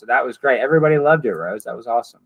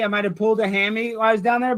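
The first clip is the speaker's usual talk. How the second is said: usual speaking voice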